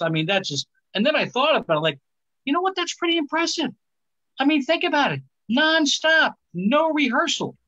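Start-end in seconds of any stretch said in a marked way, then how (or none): 2.4-7.6 mock amazement. Real, not acted. Mock-admiring the wife's voicemail.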